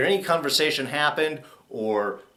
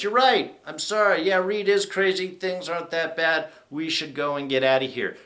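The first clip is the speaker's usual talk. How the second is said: mocking Jack; 6 semitones higher than usual. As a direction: parody him suddenly caving in. Mocking Jack's sudden agreement.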